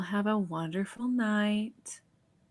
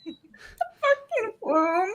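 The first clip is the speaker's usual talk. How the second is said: silly high voice; 13 semitones higher, 7 dB louder, much slower than usual. Acted.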